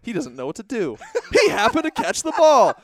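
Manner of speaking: announcer voice. Retold or imitated. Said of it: "I was like".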